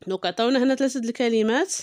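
ordinary delivery

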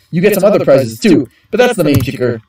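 usual speaking voice

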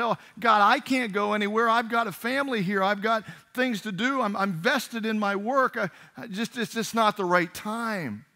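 loud, high-pitched mock protest; pleading excuses. Reluctant believer making excuses to God, loud pleading. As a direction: plead your way out, hammed up.

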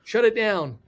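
loud, high imitation of a panicked order; freaked out. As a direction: act out an alarmed command. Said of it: "everybody freaked out".

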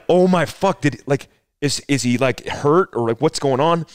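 high, loud, rattled mock panic; flustered, tripping over the words; boy panicking as his cousin collapses; alarmed. Cousin falls down, alarmed kid.

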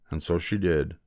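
ordinary delivery

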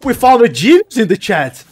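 sarcastic. Real, not acted. Mocking chat comment.

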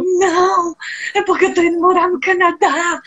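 high, teary voice, holding back sobs; retold tearful answer. To the man at airport security, a high tearful answer. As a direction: pitch it up and let the tears creep in. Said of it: "eu chorando muito".

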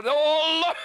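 sudden surprise. Real, not acted. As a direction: blurt it as the fish shows. Fish suddenly appears.

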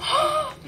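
high, sharp gasp of real surprise; shocked gasp. Real, not acted. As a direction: gasp in stunned delight. Stunned reaction to lucky card pull.

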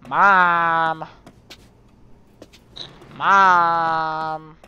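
loud call across the house. Real, not acted. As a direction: call out for mom, loudly. Calling for mom in game house.

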